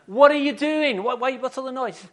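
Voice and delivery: high, loud acted protest; bothered friend. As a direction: demand to know what the racket is. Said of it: "his friend is saying".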